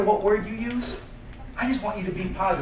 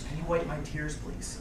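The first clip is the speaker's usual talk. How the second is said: very soft, hushed request; gentle plea; 4 semitones lower, 13 dB quieter than usual. Retold or imitated. Crying woman asking the speaker for help, gently retold. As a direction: voice her softly, fading on please.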